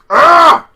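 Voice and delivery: loud, high, fed-up groan; disgusted groan. Real, not acted. Vlogger groaning at a boring win, loud. Reacting to Nia Jax match.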